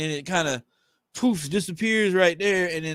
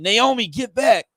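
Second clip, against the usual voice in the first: shouted warning; 6 semitones higher, 6 dB louder than usual. Acted.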